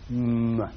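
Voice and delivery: a low humming mm with lips shut; closed-lip hum. Shown as a demonstration. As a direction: demonstrate the M by humming. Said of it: "we have to close our lips to do it".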